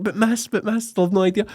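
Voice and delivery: high whiny pupil's protest; mocking pupil. Class pupil whining to the teacher, high-pitched. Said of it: "Wait till you see the like".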